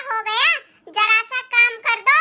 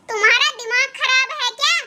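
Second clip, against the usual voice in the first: normal talking voice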